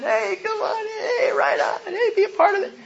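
high, hammed-up cheery call to the crowd; mock easygoing Jesus. Pastor mocking a wrong view of Jesus, hammed-up high cheer. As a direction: play a breezy, everybody's-welcome Jesus. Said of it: "wrong view of Jesus just open to everyone".

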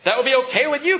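mock grumpy reply. Pastor imitating his grumpy reply to his wife, snippy mock. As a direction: ham up a tired husband's brush-off. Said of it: "Can you imagine living with me?".

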